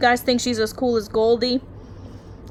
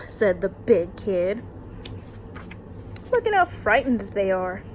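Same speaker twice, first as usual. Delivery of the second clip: plain reading voice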